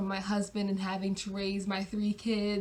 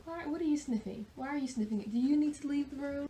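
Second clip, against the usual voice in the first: higher-pitched questions to someone off to the side; questioning aside; 5 semitones higher, faster than usual. Real, not acted. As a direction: break off and ask in a higher voice. Aside to someone sniffing nearby.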